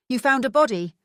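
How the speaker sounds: a rookie cop caught off guard; gasped. Acted. Cousin Francis rushing up to Trinity, startled and gasping. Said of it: "he gasped".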